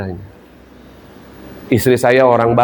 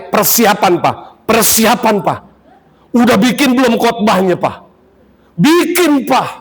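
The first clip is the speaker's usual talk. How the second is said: much higher, pushy mock of his wife; nagging wife; 8 semitones higher than usual. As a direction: nag him about his sermon like his wife. Imitating nagging wife before sermon.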